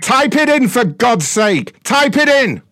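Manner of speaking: yelling furiously; heated family shouting. Real, not acted. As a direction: bellow it in frustration. Shouting at caller.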